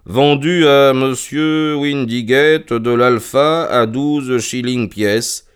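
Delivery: very slow read-aloud; reading out an entry. Acted. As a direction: draw out each item of the entry. Reads the ledger aloud on demand.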